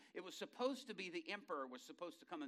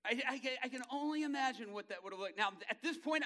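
usual speaking voice

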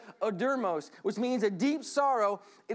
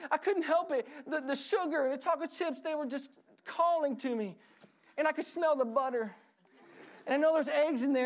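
mock wailing child; 9 semitones higher than usual. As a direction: beg mom like a caught kid. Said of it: "They start wailing".

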